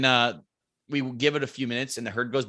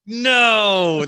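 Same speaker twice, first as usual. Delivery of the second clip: high, loud alarmed no; panicked cry; 8 semitones higher, 8 dB louder than usual. Retold or imitated. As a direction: blurt it out in horror. Panic as the horn honks.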